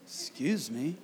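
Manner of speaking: low, soft mock objection; imitated annoyed shopper. Acting out Gabby to someone too close, mock annoyed. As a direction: imitate a crowded shopper under your breath, pitched low. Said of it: "She's in there looking".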